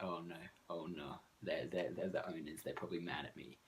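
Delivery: hushed, worried "oh no" under the breath; quietly panicking as the owners come near; panicked whisper to self. Retold or imitated. Walker mutters in a hushed panic on seeing the owners.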